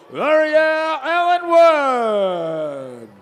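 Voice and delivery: slow, stretched announcer call; drawn-out name call. Real, not acted. Announcer draws out dancer's name.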